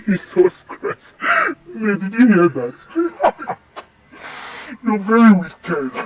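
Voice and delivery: loud, slow words through laughter; delighted shocked laughter; laughing scandalised. Acted. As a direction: laugh out the mock rebuke. Laughing at cheeky remark.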